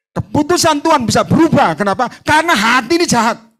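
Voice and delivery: loud, high preacher's warning; fiery preaching. Real, not acted. Pastor warning the congregation, loud fiery preaching. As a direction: thunder the warning at the church.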